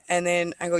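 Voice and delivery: ordinary talk, nothing marked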